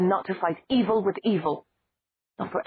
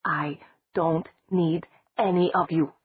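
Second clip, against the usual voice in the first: deliberate, each word stressed; 3 semitones higher, 4 dB louder than usual. Acted. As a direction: lay down each word like a threat. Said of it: "spoke deliberately, emphasizing each word".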